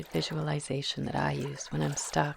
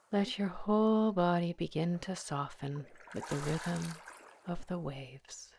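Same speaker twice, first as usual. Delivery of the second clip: guided meditation; 5 dB quieter than usual. Acted. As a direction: ease the listener into calm, one breath at a time. Leads a guided visualization.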